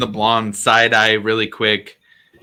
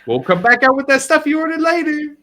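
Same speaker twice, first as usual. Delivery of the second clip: much higher, louder, cheerful shout; Michonne's voice; 5 dB louder than usual. Acted. Bartender Michonne greets the new arrivals, loud and high. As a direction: boom out a jolly hello from the doorway. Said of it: "He goes".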